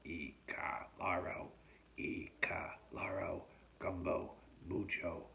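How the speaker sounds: slow, husky chant of magic words; mystic incantation. A narrator's reading. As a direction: intone it slowly like a spell, half under the breath. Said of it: "repeating the mystic line".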